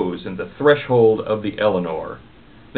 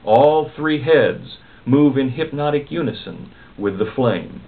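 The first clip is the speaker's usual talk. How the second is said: plain reading voice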